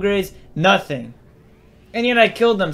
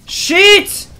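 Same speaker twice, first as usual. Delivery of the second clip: startled yelp; 13 semitones higher, 5 dB louder than usual. Real, not acted. Taking heavy damage.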